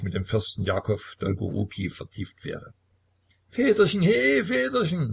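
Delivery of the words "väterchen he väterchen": sehr hoch, langsam, kindisch; betrunken schmeichelnd. A narrator's reading. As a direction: den Vater betrunken locken. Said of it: "berührte leise die Hand". (sehr hohe, lallende Kinderstimme)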